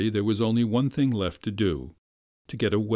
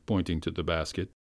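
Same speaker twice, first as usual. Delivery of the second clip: usual speaking voice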